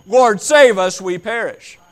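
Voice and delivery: high, loud panicked yell; screaming plea. Acted. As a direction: scream it like you're drowning. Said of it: "someone is yelling and screaming".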